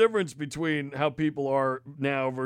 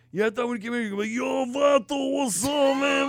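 high, loud mock-homie greeting; mocking cashier; 9 semitones higher, 4 dB louder than usual. Cashier taunting him with "yo, vato", high mocking voice. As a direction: imitate her teasing with an exaggerated street greeting.

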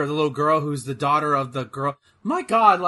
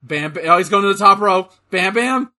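rising hype, louder each call; wrestling commentator; 3 semitones higher, 7 dB louder, slower than usual. Real, not acted. Cheering wrestler climbing top rope.

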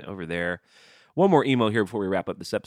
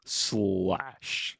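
[name] impression; 3 dB quieter than usual. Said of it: "I just tried to say it like [name]".

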